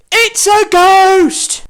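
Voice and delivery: high, loud, slow ghost cry; terrified shout. Acted. Frightened disciple cries out about a ghost, high and loud. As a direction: draw it out in pure fright.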